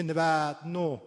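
نود و نه as n'vaad-no, long a; stretched fatha. Shown as a demonstration. Teacher demonstrating Kermani, نود و نه with drawn-out a.

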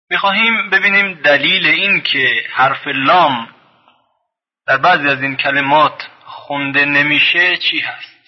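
ordinary talk

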